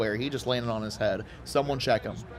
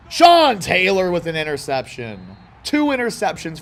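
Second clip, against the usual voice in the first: excited call; 6 semitones higher, 7 dB louder than usual. Real, not acted. Big interception play.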